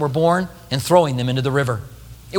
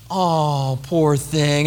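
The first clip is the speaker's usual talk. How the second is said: cooing woman; 3 semitones lower, much slower than usual. Acted. Woman cooing at crying baby.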